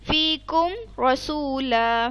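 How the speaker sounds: फीकुम रसूला recited slowly with the मीम pronounced clearly, no nasal hiding; slow clear recitation of फीकुम रसूला; izhar recitation. Shown as a demonstration. Teacher showing her student a clear izhar reading, slow. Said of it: "इजहार कर देंगे".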